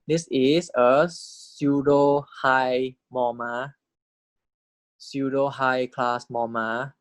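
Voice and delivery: drawn-out teacher voice; very slow dictation. Shown as a demonstration. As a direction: stretch every word out for note-taking. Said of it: "teacher dictating slowly".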